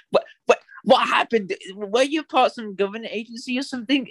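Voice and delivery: high-pitched, stammering confusion; retold bafflement. Acts out own baffled reaction.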